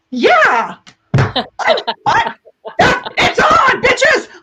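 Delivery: high, loud laughing shout; excited shout. Retold or imitated. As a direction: yell it out, laughing and pumped.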